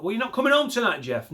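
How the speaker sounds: a petty clerk taunting Jeff; mock office worker. Imagined bank worker.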